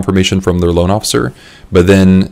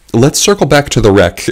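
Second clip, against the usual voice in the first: higher pitch, ending in laughter; amused dodge; 7 semitones higher than usual. Retold or imitated. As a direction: dodge it playfully, then laugh. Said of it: "I'm like".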